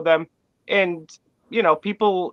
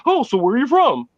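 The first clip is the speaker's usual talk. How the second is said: high, mock-eager small talk; mocking clueless asker; 7 semitones higher than usual. A podcast host mocks people who ask where you're from, in a high, mock-eager voice. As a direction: play the person asking a clumsy stock question. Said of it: "everyone asks the same stupid questions".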